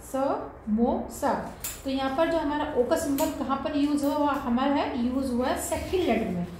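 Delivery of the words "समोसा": समोसा drawn out very slowly, syllable by syllable; teacher repeating the word slowly for pupils; slow teaching repeat. Shown as a demonstration. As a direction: stretch समोसा so the ओ in the middle is clear. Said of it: "जो मैंने अभी letter बोला समोसा".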